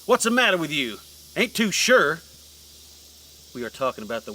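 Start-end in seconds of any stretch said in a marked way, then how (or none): 0.1-2.2 indignant. Acted. Preacher repeats louder.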